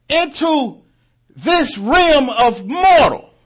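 high, loud fervent preaching cry; preaching at full force. Acted. Preacher crying out to the congregation, fervent full-force preaching. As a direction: preach it out at the top of the voice.

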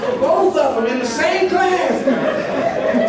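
high, loud, laughing through every word; real laughter. Real, not acted. Preacher laughing hard at the classmates calling him a preacher. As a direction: crack up while you tell it. Teller laughs retelling the girl's remark.

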